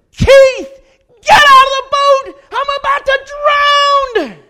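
very high, all-out desperate yell; panicked scream. Retold or imitated. Drowning man begging his friend to leave the boat, panicked scream. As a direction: scream for your life, stretching the end.

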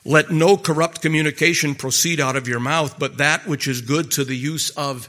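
preacher reading Ephesians to the congregation, slow and solemn; scripture reading. Acted. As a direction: read the verse with weight. Reads scripture aloud.

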